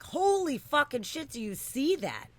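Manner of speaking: high, loud freaked-out yell; retold panicked yell at the sky; panicked shout. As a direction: shout it like you just saw a UFO. Retold panic at seeing object.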